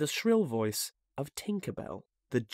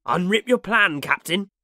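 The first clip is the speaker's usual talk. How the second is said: loud, higher, urging the captain on; eager cry; 7 semitones higher, 7 dB louder than usual. A narrator's reading. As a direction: call out eagerly to your leader. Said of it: "he cried eagerly".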